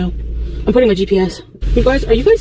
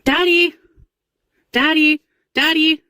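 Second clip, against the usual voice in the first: repeated calling; 5 semitones higher, slower than usual. Acted. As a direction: call out over and over, high. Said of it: "I'm not yelling, Daddy".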